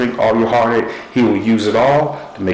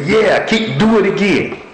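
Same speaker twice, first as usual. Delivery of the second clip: mocking crowd cheer; 9 semitones higher than usual. Preacher imitating opposing fans cheering a mistake, high gloating cheer. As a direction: cheer like a rival crowd enjoying your mistake. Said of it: "They're cheering, they're yelling".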